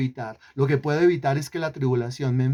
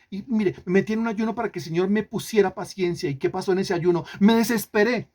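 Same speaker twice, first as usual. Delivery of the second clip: ordinary talk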